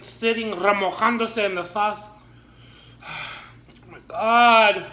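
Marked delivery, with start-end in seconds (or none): 3.0-4.9 very slow loud groan; drawn-out pained groan. Real, not acted. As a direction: stretch it out in agony. Burning from spicy food.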